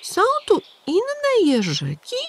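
put-on high childlike wondering question; a small boy amazed; curious little hedgehog. A narrator's reading. Little Igiełka asking the pigeon, high child voice.

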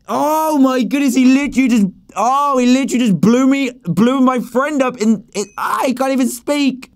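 high, loud flustered outrage; stunned and upset at once; shocked disbelief. Real, not acted. Reacting to friend's death.

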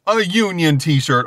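slow, emphatic, falling statement; amazed repeat. Real, not acted. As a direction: repeat it in awe, landing it firmly.

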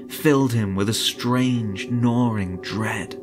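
ordinary delivery, nothing marked

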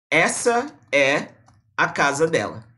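slow example sentence with pauses; frase de exemplo. Shown as a demonstration. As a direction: lay the phrase out piece by piece. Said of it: "costume de falar o quê". (frase de exemplo devagar, com pausas)

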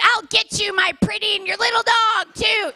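high, shouted witch threat; wicked witch voice. Retold or imitated. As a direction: shriek it like a storybook witch at her kid. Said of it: "I turned into my mother".

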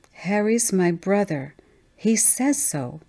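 put-on slow, stubborn little-girl voice; child insisting. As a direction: drag it out, refusing to budge. Child insists after being contradicted.

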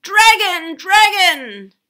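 high, very loud holler; alarm shout. A narrator's reading. Arabella raising the alarm from the hill, shouting. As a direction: shout urgently from the hilltop. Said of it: "there came another shout from the hill".

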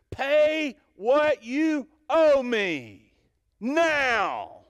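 slow, loud, high furious demand; angry demand. Acted. Servant shouting at his fellow servant, furious and slow. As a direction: bark the debt at him, drawn out. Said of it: "And in anger, he says".

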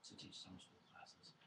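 ordinary talk

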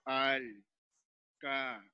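"al, ca" with a pause after each piece; teacher splitting off "al, ca" one by one; separated syllables. Shown as a demonstration. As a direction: sound out "al, ca" slowly.

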